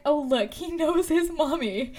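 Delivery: lightly acted proud parent; Hagrid. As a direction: gush slowly over a beloved baby pet. Voicing Hagrid doting on dragon.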